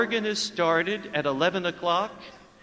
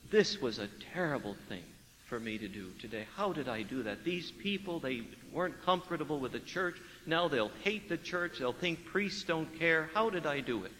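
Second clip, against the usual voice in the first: soft, lowered self-reproach; remorseful inner voice; 3 semitones lower, 11 dB quieter than usual. Retold or imitated. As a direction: think it to yourself in regret.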